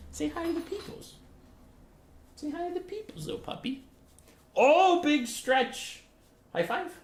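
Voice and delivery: very high, soft cooing. Real, not acted. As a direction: coo to the puppy softly. Cooing to puppy.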